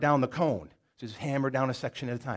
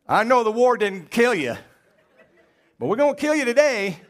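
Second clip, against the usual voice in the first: mock threat; 7 semitones higher, 7 dB louder than usual. Joking threat to Uncle Bob.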